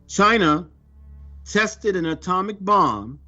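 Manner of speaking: usual speaking voice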